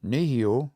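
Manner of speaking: Nehio with the first syllable, ne, stressed. Shown as a demonstration. Teacher models Nehio for repetition, first-syllable stress. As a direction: model Nehio clearly, leaning on ne. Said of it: "Make sure you're saying these out loud".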